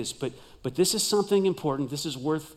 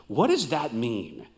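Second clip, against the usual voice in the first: ordinary talk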